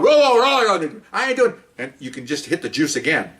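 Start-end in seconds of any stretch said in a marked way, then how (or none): 0.0-1.0 high-pitched yelled command; imitated yelling. Officer orders tasered suspect down, imitated yell. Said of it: "and she's yelling". 1.1-1.5 high-pitched suspect's refusal; retold protest. Tasered suspect refuses officer's order, high protest.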